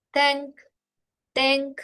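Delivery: "tank" with a Spanish e and ng; ng final. Shown as a demonstration. Teacher drilling the ng ending. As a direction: clear e, then a nasal ng. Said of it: "N G".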